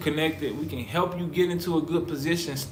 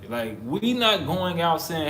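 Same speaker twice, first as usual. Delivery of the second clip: usual speaking voice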